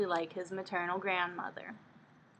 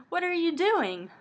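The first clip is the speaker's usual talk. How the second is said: startled protest; 10 semitones higher, 6 dB louder than usual. Acted. Stranger suddenly hugs Shizuku.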